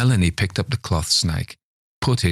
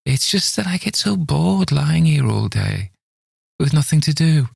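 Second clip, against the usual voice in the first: put-on whiny old man; 9 semitones higher, 3 dB louder than usual.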